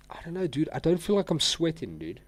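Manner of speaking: high, puzzled voice; high puzzled doubt. Real, not acted. Puzzled about not sweating.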